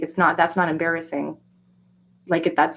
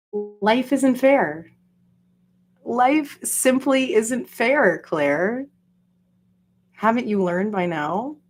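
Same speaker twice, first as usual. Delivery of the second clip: mock lecture; 4 semitones higher, slower than usual. Lecturing Claire, mock-wise.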